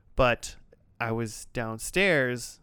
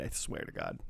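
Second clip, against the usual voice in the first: whispered; 12 dB quieter than usual. Real, not acted. Mock-solemn aside.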